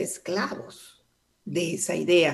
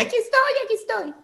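much higher, squeaky cartoon-toy voice; talking wrench; faster than usual. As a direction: play a tiny tool calling out cheerfully. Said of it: "brincara la llave Stilson y me dijera". (voz aguda de herramienta que habla)

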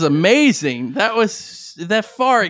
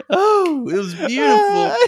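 laughing; 12 semitones higher than usual. Real, not acted. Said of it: "I am crying laughing".

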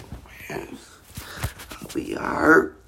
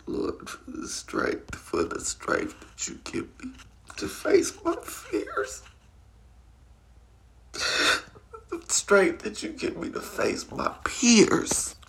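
whispered prayer; 7 semitones higher than usual. Real, not acted. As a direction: pray it under your breath, grateful.

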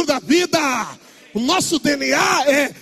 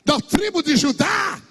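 ordinary talk

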